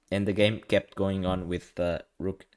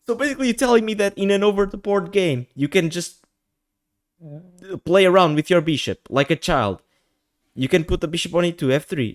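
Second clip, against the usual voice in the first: loud, high-pitched incredulous objection; indignant protest; 8 semitones higher, 7 dB louder than usual. Retold or imitated. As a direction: argue back at the absurd rule, raised voice. Retold protest to the arbiter.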